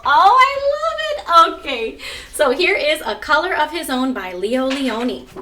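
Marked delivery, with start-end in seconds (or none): 0.0-1.6 high, loud, stretched-out "I love it"; delighted squeal. Real, not acted. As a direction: gush with delight at the punchline. Delighted reaction to joke.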